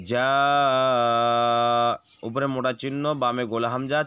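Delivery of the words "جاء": جاء with its madd fully drawn; drawn-out vowel. Shown as a demonstration. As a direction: stretch the long a in جاء for two beats. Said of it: "টেনে পড়বো".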